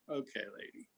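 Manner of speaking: low, quiet eye-rolling mutter; muttered exasperation. Acted. Fed-up clerk muttering about a customer returning a Yeti, quiet. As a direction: sigh it out under your breath.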